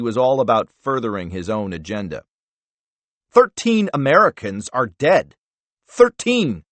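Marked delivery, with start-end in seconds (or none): 3.3-6.6 higher and louder angry bark, the last "Thirteen" leaping up; barking in anger. Acted. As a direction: hammer home the "Thirteen" in fury. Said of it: "Wells barked".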